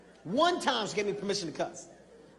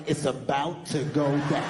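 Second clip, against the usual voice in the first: ominous; 7 semitones lower, slower than usual. Retold or imitated. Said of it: "I was like".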